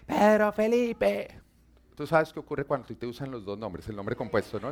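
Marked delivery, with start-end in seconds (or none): 0.1-1.3 long, drawn-out call of "Pedro Felipe", higher, from another room; mom calling out. Retold or imitated. Mother shouts her son's full name across the house, drawn out. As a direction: stretch "Pedro Felipe" like an angry mother summoning her son. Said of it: "desde allá me grita".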